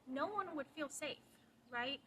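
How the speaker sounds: ordinary talk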